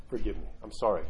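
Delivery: bow your head and say it gently; humble apology. Retold or imitated. Husband apologising to his wife, soft and humble. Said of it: "I make a bow and I say".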